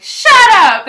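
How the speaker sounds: loud, high-pitched comeback to teasing; mock outraged yell. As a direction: yell it playfully at teasing friends. Retold comeback to teasing friends.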